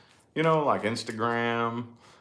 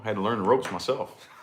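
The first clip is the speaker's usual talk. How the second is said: usual speaking voice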